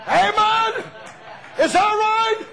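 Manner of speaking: high, loud shouting preacher; full-force revival preaching. Acted. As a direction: whip the congregation up, calling out. Preacher shouts after the story.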